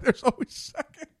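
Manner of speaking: high, giggling repeat of the joke; laughing echo. Real, not acted. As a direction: laugh it back. Echoing joke through laughter.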